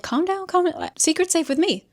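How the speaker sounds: high, light mock soothing; calm her down in a high voice; high-pitched calm down. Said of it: "Christina's like".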